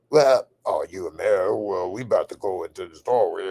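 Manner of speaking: foreigner's mock American accent; imitated American impersonation. Host mimicking his English friend's fake American accent. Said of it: "hear people do American impersonations".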